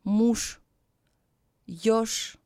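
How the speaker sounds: "муж, ёж" ending in sh; final Ж as Ш. Shown as a demonstration. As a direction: model муж as moosh and ёж as yosh. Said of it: "is pronounced as ш".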